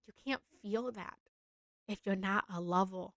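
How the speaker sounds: normal talking voice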